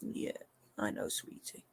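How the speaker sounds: soft whisper soothing the cat; whispered comfort. Real, not acted. Owner whispering comfort to her restless cat. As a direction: hush the cat gently.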